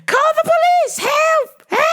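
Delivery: high shrieking imitation of a panicked woman; mock cries for help. Handcuffed woman crying out to bystanders, high mock shriek. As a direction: yell for help like you're being hurt. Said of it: "she was like".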